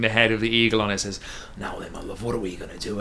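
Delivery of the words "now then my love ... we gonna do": much lower, much softer tender murmur; cooing to staff. Acted. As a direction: croon to the staff like a lover.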